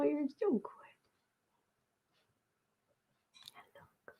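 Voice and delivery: soft, hushed cooing to a pet. Real, not acted. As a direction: murmur sweet nothings to a dog. Long whispered cooing to pet.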